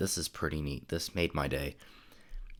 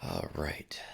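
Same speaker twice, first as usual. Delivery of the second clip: whispering; 10 semitones lower, 5 dB quieter than usual. Real, not acted. Muttering to himself.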